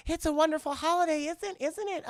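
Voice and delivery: high, put-on wifely voice; cheery wife voice. Wife trying to share the holiday with her husband, mock-sweet and high.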